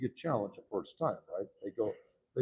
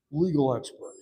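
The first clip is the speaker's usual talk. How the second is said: sarcastic; 3 semitones higher, 10 dB louder than usual. Retold or imitated. Mocking her rise, louder second time.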